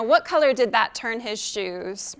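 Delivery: ordinary delivery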